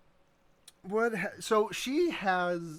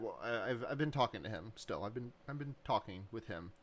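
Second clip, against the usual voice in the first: quoting Voss quietly; 6 semitones lower, 8 dB quieter than usual. Retold or imitated. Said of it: "and she's like".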